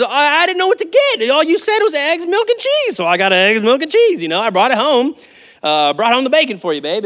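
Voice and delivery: a sheepish husband pleading he did as told; innocent husband. Retold or imitated. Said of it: "the husband innocently says".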